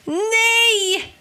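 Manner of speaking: high, loud drawn-out scream. A narrator's reading. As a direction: scream it out in protest. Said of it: "skrek Ben".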